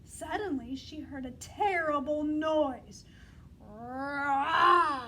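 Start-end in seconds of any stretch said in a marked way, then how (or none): 3.6-5.0 loud roar of a bear; growling roar. Shown as a demonstration. Teacher roaring for the children, loud. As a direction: roar like a big bear for the class. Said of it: "Growl with me".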